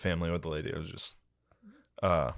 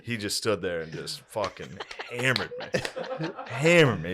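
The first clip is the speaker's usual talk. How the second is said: usual speaking voice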